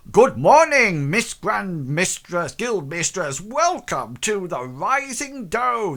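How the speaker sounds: booming, high-pitched shopkeeper welcome; loud voice. Acted. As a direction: bellow a grand greeting. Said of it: "says in this loud voice".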